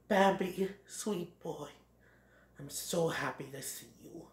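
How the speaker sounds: very soft, fatherly murmur; tender welcome. Acted. Great Prince greets his found son, tender soft voice. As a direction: greet the child gently and quietly.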